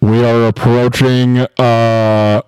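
slow airline captain voice; pilot announcement. Pretend. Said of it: "a pilot on an airplane".

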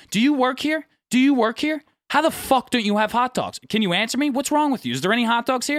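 retold exasperated rant; irate customer. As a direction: act out a customer berating a store clerk. Retold customer berating a store worker.

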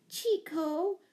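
high, rising call; despairing cry. A narrator's reading. Owner calling to her misbehaving monkey, despairingly. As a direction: plead with the name, pitched well up. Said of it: "cried Miss Giddings despairingly".

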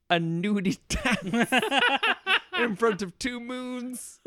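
slow high words through laughter; laughing. Real, not acted. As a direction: crack up while saying it. Laughing at own joke.

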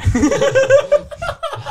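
loud high shriek of laughter. Real, not acted. Laughing at the roast.

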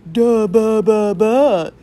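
very loud, slow babbling imitation; drawn-out mocking nonsense sounds; mocking mimicry. A narrator's reading. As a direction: drag out each syllable in loud, cruel mockery. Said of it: "mimicked Willie".

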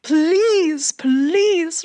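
very high, wailing begging; high-pitched drawn-out mock wail; mock screaming plea. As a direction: imitate a desperate man pleading as he's dragged along.

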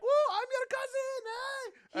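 very high, whooping call; imitated yelling. Middle Eastern dad hailing his new cousins, high whooping yell.

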